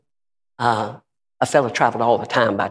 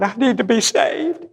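put-on crying girl; 9 semitones higher than usual. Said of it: "came down the aisle crying".

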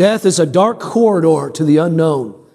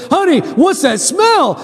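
high-pitched, drawn-out husband's delight; acting out an excited husband coming home; 11 semitones higher, slower than usual. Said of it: "he gets excited".

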